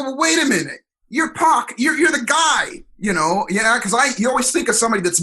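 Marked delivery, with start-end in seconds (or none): 0.0-3.5 surprised disbelief. Retold or imitated. Surprised at master's confession.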